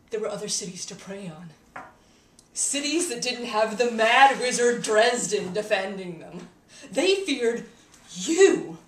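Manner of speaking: loud, mounting intensity; impassioned accusation. Acted. Telling Harry that monsters feared him, loud accusation. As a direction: build loudly to a hard-hit final word. Confronting Harry about his reputation.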